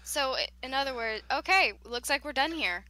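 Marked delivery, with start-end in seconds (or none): none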